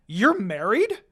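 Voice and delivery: loud, startled exclamation; exclaiming. Acted. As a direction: blurt it out in surprise. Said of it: "Lady Tsunade exclaimed".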